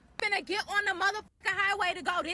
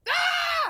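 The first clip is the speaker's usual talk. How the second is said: high, short angry cry; frustrated yell; 9 semitones higher, 3 dB louder than usual. Real, not acted. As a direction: let out a fed-up shout. Frustrated outburst.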